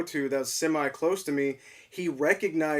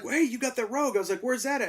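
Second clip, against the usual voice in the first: eager question; 8 semitones higher, faster than usual. Retold or imitated. Retelling asking store clerk.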